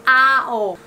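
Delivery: HOW's vowel drawn out as "ah-oh"; vowel sounded out. Shown as a demonstration. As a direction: stretch the "ow" of HOW into ah-oh. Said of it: "why is how pronounced ao".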